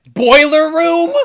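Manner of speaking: shocked disbelief. Real, not acted. Incredulous squeal.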